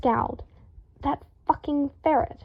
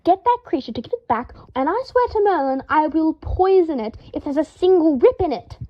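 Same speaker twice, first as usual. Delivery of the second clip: higher, louder furious threat; higher, louder seething threat; 5 semitones higher, 4 dB louder than usual. A narrator's reading. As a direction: threaten to poison the ferret.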